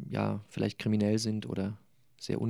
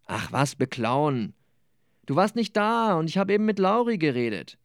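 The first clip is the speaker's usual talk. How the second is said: lebendige Romanlesung mit gespielten Figuren; Lesung; 3 semitones higher, 4 dB louder than usual. Acted. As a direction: lies die Szene wie bei einer Lesung vor Publikum. Autor liest aus seinem Roman. (Autor liest vor Publikum aus seinem Roman, lebhaft vorgetragen)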